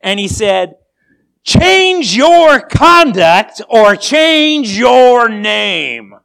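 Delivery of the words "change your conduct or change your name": furious, high, drawn-out roar; enraged general's thundering command; exploding in anger. A narrator's reading. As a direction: bellow the ultimatum slowly at full volume. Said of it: "exploded in anger".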